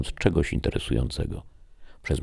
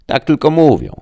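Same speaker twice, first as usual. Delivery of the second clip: high, loud offhand village wife; dismissive gossip; 12 semitones higher, 11 dB louder than usual. A narrator's reading. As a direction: brush it off, much higher. Wife shrugs off criticism. (lekceważąco, wysokim głosem)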